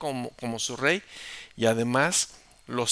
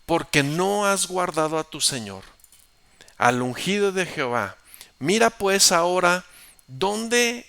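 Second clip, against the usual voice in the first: taunting shout; 3 semitones higher, 6 dB louder than usual. Acted. David shouting to Abner about the king's spear, loud. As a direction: show him the proof, calling out.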